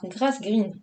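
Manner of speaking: English name in a very French accent; drawn-out French-accented English. Real, not acted. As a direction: say it slowly with a strong French accent. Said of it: "Avec mon accent très français".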